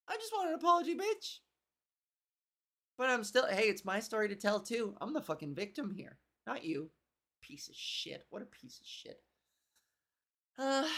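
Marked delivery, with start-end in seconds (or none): 0.1-1.4 high, bratty demand for an apology; Ava's voice, a high bratty demand; mock demanding voice. Voicing Ava demanding apology. 7.4-9.2 whispered, soft dig under the breath; an aside insult, whispered. Real, not acted.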